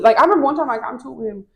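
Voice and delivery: ordinary talk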